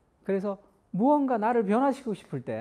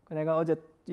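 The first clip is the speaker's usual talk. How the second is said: normal talking voice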